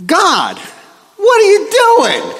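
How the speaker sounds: high, drawn-out cry to God; exasperated protest. Acted. Preacher as bitten Paul protesting to God, exasperated.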